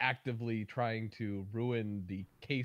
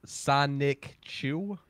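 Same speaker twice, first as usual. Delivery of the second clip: "Sonic Chew" drawn out slowly and louder; slowed right down; 7 dB louder, much slower than usual. Shown as a demonstration. Friend asked to hear the name slower, "Sonic Chew" very slow. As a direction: stretch out each syllable of "Sonic Chew", loudly. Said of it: "say again slower".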